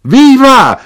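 very high, very loud cheer; drunkard's shout. A narrator's reading. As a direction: yell it out as a tavern drunk. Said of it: "gridò un ubriaco".

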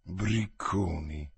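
low, slow, soft grumble; grumbled. Acted. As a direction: grumble it under the breath. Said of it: "brontolò". (borbottio basso e lento)

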